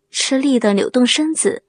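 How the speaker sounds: ordinary delivery, nothing marked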